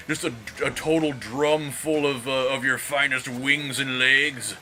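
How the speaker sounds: mock Kuwaiti accent. Imagined Kuwaiti customer ordering chicken, mock Kuwaiti accent. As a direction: order fast food in a jokey Kuwaiti accent. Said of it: "what a Kuwaiti, uh, accent sounds like".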